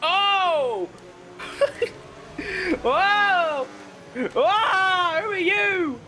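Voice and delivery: high, drawn-out whoas of alarm; startled exclamations. Real, not acted. Streamer startled by another driver, high astonished cries. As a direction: cry out high in amazement.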